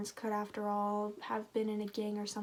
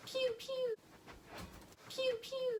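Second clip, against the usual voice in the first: toy gun pew pew; 4 dB quieter, slower than usual. Pretend. Mimicking gunshots.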